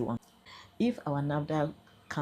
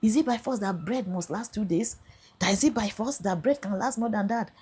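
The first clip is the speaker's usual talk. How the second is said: high-pitched scolding snap, raised and pushy; retold angry retort; 7 semitones higher, 3 dB louder than usual. Senior officer scolding the detained woman, high angry snap. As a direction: throw it back at her like a furious officer. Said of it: "He said".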